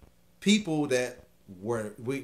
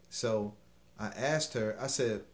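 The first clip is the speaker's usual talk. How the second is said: ordinary delivery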